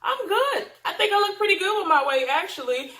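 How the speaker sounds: high, loud, laughing self-assurance; answering the weight comment, laughing and proud; confident retort. Retold or imitated. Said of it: "and I was like".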